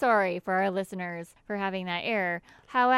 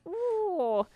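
high, quick Irish leprechaun ooh; 9 semitones higher than usual. Pretend. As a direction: squeal it like a cartoon leprechaun. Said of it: "that was my leprechaun voice".

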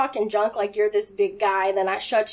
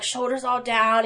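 usual speaking voice

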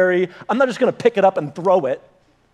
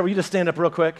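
usual speaking voice

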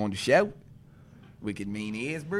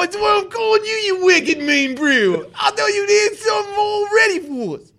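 cranks it up, nearly shouting; excited yelling; 12 semitones higher than usual. Real, not acted. Hyped calling on a friend.

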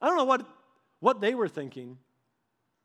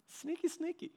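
sneaky hushed aside; 9 semitones higher, 9 dB quieter than usual. Retold or imitated. Mock whisper at the cop.

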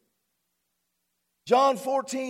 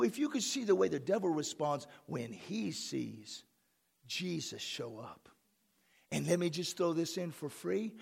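usual speaking voice

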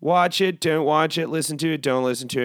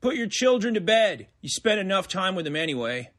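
mock broadcast disclaimer read; deadpan rating announcement; announcer voice; 5 semitones higher than usual.

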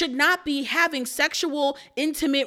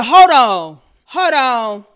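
retold shout; 3 semitones higher, 9 dB louder than usual. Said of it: "I said".

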